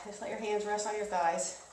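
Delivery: gentle, reassuring coach; soft cool-down voice. Real, not acted. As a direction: ease them down softly. Cool down encouragement.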